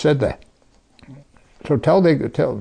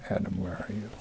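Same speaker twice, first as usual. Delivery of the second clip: much softer, gentle call; hushed calling; 5 semitones lower, 15 dB quieter than usual. Acted. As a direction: call him softly as if searching. God calling Adam, softer repeat.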